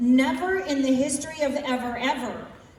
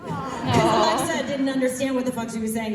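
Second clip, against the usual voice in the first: usual speaking voice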